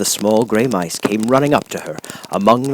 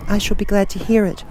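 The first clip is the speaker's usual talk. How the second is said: put-on high girl's question; curious young girl; 13 semitones higher than usual. A narrator's reading. Girl curious about the charm, high voice. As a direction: ask it wide-eyed as the girl.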